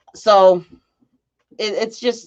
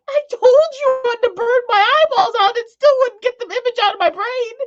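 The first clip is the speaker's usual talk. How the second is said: exasperated squeal. Real, not acted. Scolding friend, laughing, high.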